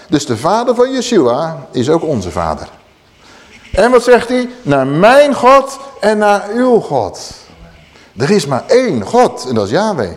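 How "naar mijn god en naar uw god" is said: very slow, weight on both God; slow, lifted declaration; solemn emphasis. Acted. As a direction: proclaim each God deliberately. Yeshua's words quoted emphatically.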